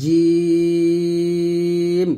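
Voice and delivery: জিম drawn out long in a held Arabic recitation tone; Arabic letter jim. Shown as a demonstration. Teacher showing students the Arabic letter জিম, long and drawn out. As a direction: stretch জিম slowly, tongue middle to the palate, Arabic style. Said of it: "জিমটা জিহ্বার মধ্যখান ... উচ্চারণ করতে হবে".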